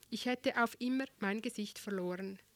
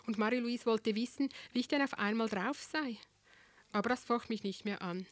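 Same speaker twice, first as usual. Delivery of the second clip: ordinary delivery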